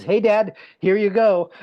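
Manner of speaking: put-on high, loud nurse handing over a baby; chirpy nurse. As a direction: imitate a nurse calling out brightly to a new dad. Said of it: "and the nurse holds this baby and says".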